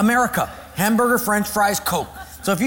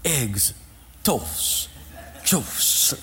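hesitant quiet order; 6 semitones lower, 5 dB quieter, much slower than usual. Retold or imitated. Man orders new words hesitantly.

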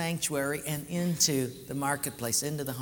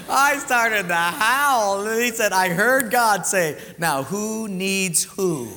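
amused; 2 semitones higher, 7 dB louder, slower than usual. Real, not acted. Still amused telling punchline.

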